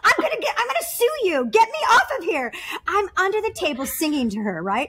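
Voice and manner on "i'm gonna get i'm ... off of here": high, loud mock outrage, laughing at the start; mom raging. Daughter imitating her mom yelling at the doctors from the table, high and loud. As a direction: belt out a mother's furious protest in a high voice. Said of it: "my mom is going".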